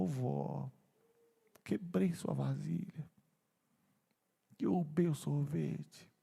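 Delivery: soft, slow, choked-up admission; tearful confession. Acted. Grandson confessing the broken bowl to his grandmother, tearful and soft. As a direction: confess it haltingly, close to tears. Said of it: "Eu nunca contei essa história sem chorar".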